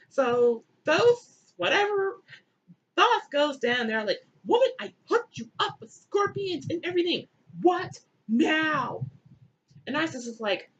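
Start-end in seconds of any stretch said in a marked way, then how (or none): 4.5-9.1 high-pitched, put-upon complaint; hammed-up, higher-voiced annoyance; mock exasperation. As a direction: whine it like a hassled helper. Thoth annoyed at Isis, acted.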